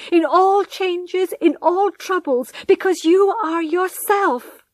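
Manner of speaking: high, loud, impassioned appeal, stretching key phrases; desperate pleading. A narrator's reading. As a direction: plead desperately for his love. Wife begs husband in anguish.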